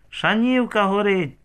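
high narrated schoolboy cry; storyteller's small-child alarm call, high-pitched; thin child's squeal. A narrator's reading. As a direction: squeal it thinly like a little boy. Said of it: "тоненько заверещав Тарасик".

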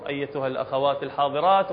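ordinary talk

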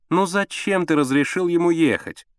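high, whiny complaint; petulant woman, pitched high; capricious. A narrator's reading. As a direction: fret peevishly at your husband. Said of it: "капризным голосом ответила Алла Сергеевна".

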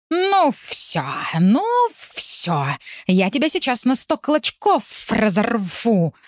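high, slow hissed threat; hissing threat. Acted. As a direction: hiss like a furious tomcat. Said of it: "шипел он".

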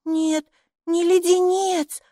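high, slow, drawn-out denial; correcting child. A narrator's reading. Майки corrects the gatekeeper, high emphatic child voice.

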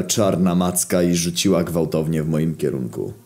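ordinary delivery

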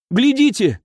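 a high, sharp cry to draw eyes; sudden shout. Acted. Someone in the crowd points at the dead dog, shouting. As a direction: call out high to make everyone look. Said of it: "крикнул кто-то".

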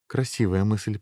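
ordinary delivery, nothing marked

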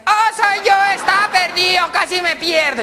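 high, squealing little-pig voice; piglet voice. Acted. Piglet greets owner at the door after getting lost, piglet voice.

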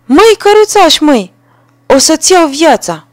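very high, loud call through the window; sparrow shouting. A narrator's reading. Sparrow at the window shouting at the carter, loud high call. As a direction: yell a death threat. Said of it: "a strigat".

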